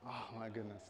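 low, very soft exclamation; hushed, muted take; understated exclamation. Shown as a demonstration. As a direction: say it softly and low, with little excitement. Trying out a suggested exclamation.

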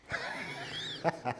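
sharp mouthed pistol shot; imitated gunshot. Gunslinger firing at his rival, imitated shot. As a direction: make a gun go off with the mouth.

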